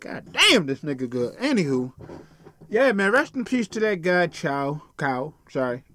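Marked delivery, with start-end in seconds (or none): 0.0-1.4 mock tribesman. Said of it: "They can be like".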